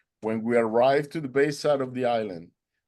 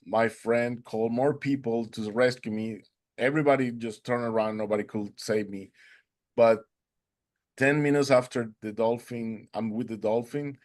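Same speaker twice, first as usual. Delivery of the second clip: ordinary talk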